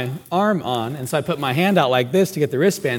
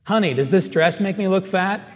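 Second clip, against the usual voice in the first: mock wife question; 7 semitones higher, 4 dB louder than usual. Wife asks husband the classic question.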